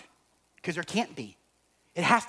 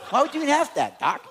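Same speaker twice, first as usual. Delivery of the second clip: high, louder laughing quip; 10 semitones higher, 6 dB louder than usual. Real, not acted. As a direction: laugh through the jokey retort. Joking reply, laughing.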